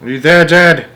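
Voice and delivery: high, loud desperate call; boy shouting for his lost father. A narrator's reading. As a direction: yell into the darkness. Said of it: "I shouted".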